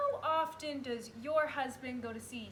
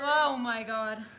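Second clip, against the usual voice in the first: loud, drawn-out "Oh my God"; aghast, slow and loud; shocked; 9 dB louder, slower than usual. Acted. Shocked reaction to the confession.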